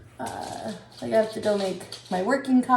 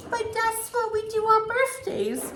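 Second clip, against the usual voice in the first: put-on much higher, slow child's protest; earnest little girl; 12 semitones higher than usual. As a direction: plead like a sweet small child. Mother voices daughter.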